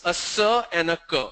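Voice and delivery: "S" as suh and "K" as kuh; letter sounds. Shown as a demonstration. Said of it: "The same letter C becomes".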